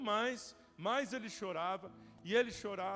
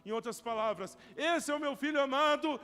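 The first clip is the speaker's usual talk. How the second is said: proud proclamation; 4 semitones higher, 5 dB louder than usual. Acted. God's voice at baptism.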